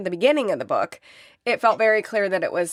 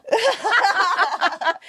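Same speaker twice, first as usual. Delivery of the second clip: burst of laughter; 11 semitones higher than usual. Real, not acted. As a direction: laugh it off, caught out. Laughs at the question.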